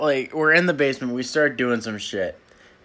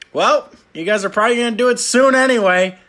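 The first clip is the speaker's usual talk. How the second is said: high, quick, louder dad voice; breezy dad; 8 semitones higher, 5 dB louder, faster than usual. Retold or imitated. Dad tossing condom at the couple, imitated breezy. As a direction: rattle it off high and matter-of-fact.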